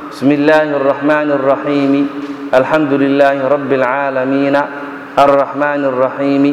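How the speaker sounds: fast unchanted verses, each keeping its final vowel, "raheemi", "aalameena"; brisk reading with case vowels at every stop; wrong pausal reading. Shown as a demonstration. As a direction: read الرحيم and العالمين with short open endings, no elongation. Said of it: "Saas looma akhriyo".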